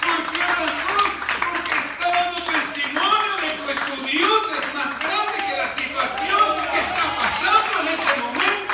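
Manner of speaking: loud, high preaching; preaching at full force. Real, not acted. Preacher proclaiming testimony to the congregation, loudly.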